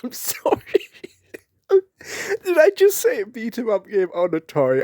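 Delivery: real giggling fit, voice pushed high; laughing to tears. Real, not acted. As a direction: apologise through helpless, high-pitched laughter. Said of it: "I'm gonna cry".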